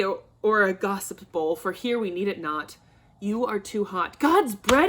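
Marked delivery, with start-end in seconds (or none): 4.2-4.9 furious oath. Acted. Father raging at daughter's refusal.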